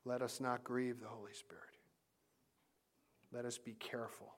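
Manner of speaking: hushed whisper. Real, not acted. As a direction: whisper it gently. Hushed plea in sermon.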